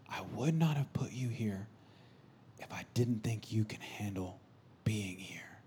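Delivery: slow, whispery imitated dad; low-volume, deliberate and intimate; hushed fatherly encouragement. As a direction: say it softly to a tearful son. Retells dad's solemn encouragement.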